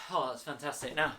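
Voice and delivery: ordinary talk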